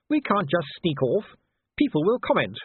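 high-pitched, ladylike insistence; Sybil, reproving wife. A narrator's reading. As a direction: remind the husband firmly, pitched high.

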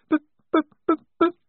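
high, stuttering start, too upset to speak; stammering. A narrator's reading. Wazza stammering over the crushed coffee engine. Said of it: "she began".

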